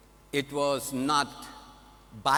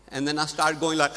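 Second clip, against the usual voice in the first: laughing cry. Retold or imitated. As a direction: let the tears break into a choked laugh. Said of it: "I'm getting misty-eyed and I'm teary-eyed".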